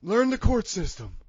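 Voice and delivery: slow, smug put-on character voice; Big Johnny voice. Big Johnny brags about his lawyer, slow smug character voice. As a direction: lecture him in character, cocky. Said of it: "but Big Johnny".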